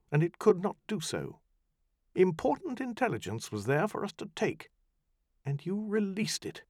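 high, icy reproach; high cutting scorn; cold rebuke. Acted. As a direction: freeze him with disdain.

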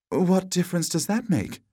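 high-pitched damsel retort; damsel, impatient. A narrator's reading. Damsel waving away the knight's question, high-pitched retort.

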